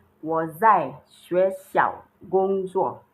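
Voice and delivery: slow, clear, each Chinese syllable apart; slow model sentence. Shown as a demonstration. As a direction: say it slowly for the class to repeat. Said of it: "You now say".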